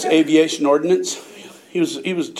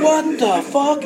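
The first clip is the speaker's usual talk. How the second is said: stunned disbelief; 9 semitones higher, slower than usual. Retold or imitated. Startled at finding weed plant.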